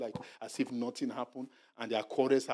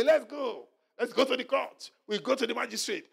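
high, loud, cocky challenge; mock defiance; 10 semitones higher, 4 dB louder than usual. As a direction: dare them to take it to court. Mocking a defiant, litigious spouse.

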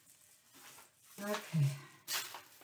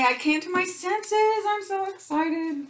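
high, loud sarcastic glee; mock excitement; 7 dB louder than usual. Joking with viewers, high mock excitement. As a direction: overplay the joy ironically.

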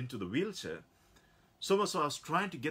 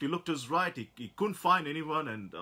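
ordinary delivery

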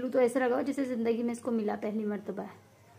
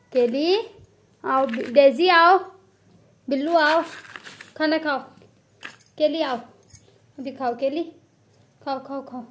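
loud call to the cats; 3 semitones higher, 11 dB louder than usual. Real, not acted. Owner calling her cats loudly to come eat. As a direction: call the cats over to their food.

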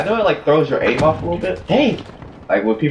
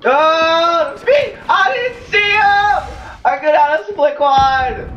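panicked shout; 13 semitones higher, slower than usual. Real, not acted. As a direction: scream it out in shock. Killed by unseen enemy.

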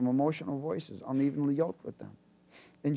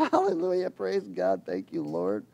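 mock joyful praise; 7 semitones lower than usual. Preacher leaving joyful, mock praise. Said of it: "So I left there all joyful".

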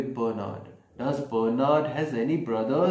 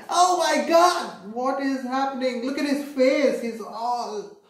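father reacting to hitting his finger; mock shock; 7 semitones higher than usual. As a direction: play the startled dad for the kids. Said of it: "And he was like".

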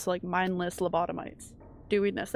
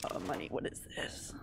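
low whispered wonder; hushed discovery; 10 dB quieter than usual. Real, not acted. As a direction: whisper as you find it. Finds money while sneaking.

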